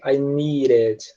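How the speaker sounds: "need it" drawn out and linked as "nee-rit"; American D like R. Shown as a demonstration. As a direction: slowly say "I need it" with an R-like D. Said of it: "o D vira um R".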